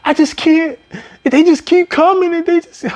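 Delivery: high, cracking imitation of a sob; mock crying. Overwhelmed new hire crying about endless shakes, high mock sob. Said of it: "broke down and started crying".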